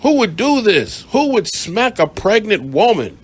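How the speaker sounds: high, loud mock outrage; mock outraged cops. Police condemning the man, in mock outrage. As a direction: ham up their disgust at him. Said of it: "They was like".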